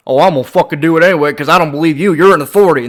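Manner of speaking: mock the stubborn know-it-all, fast and loud; sneering imitation of a defiant fool; mocking defiance.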